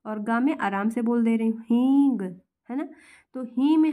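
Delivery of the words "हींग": "हींग" with a loud, pressed first syllable; stress on hee. Shown as a demonstration. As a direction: punch the ही in "हींग", then ease off on the end. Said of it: "ही में ज़्यादा मुझे जोर देना पड़ रहा है ना". ("हींग" में ही पर ज़ोर)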